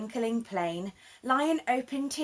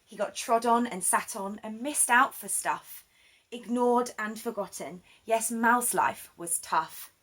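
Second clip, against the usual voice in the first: plain reading voice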